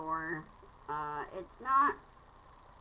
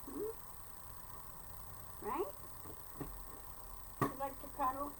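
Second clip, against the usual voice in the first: talking to a pet; 6 semitones higher, 7 dB quieter than usual. Real, not acted. Cooing question to the cat.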